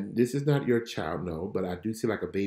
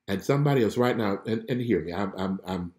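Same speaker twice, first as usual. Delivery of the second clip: normal talking voice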